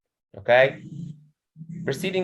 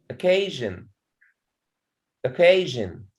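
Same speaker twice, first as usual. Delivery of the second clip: "occasion" as o-CA-sion, weight on CA; teacher's "occasion", falling after a stressed CA; stress on middle syllable. Shown as a demonstration. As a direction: model o-CA-sion twice. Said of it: "So the stress in K".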